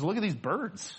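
hushed, quick, reassuring voice of God; lightly voiced God, soft and close; God's gentle reassurance. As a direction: softly reassure the worried listener as God. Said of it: "He says".

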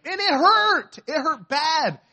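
high, emphatic complaint of pain; pained exclamation. Retold or imitated. Pastor telling how the stings hurt, high pained exclamation. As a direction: wail it for comic effect, voice jumping up. Comic retelling of painful stings.

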